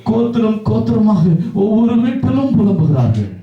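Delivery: high, sustained chant-like proclamation; impassioned preaching. Acted. Preacher describing every household of the land lamenting, fervent. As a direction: build the list phrase by phrase, pitch climbing.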